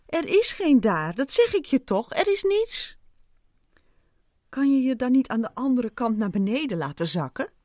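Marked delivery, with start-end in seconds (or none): 0.1-3.0 exasperated. A narrator's reading. Ant telling squirrel there is nothing there, exasperated. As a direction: pitch jumping up at the end.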